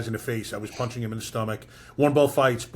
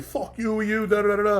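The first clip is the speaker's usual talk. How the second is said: high-pitched imitation of an angry rant, without real volume; mock angry yell; 10 semitones higher than usual. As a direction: mimic his yelling, tailing into filler syllables. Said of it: "he's yelling".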